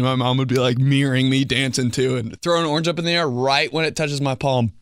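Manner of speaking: ordinary talk, nothing marked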